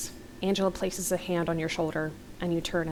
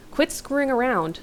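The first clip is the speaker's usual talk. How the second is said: higher, motherly scolding; light telling-off from a mother figure; almost motherly; 6 semitones higher than usual. A narrator's reading. Heather telling Jimmy off, almost motherly. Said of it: "almost motherly".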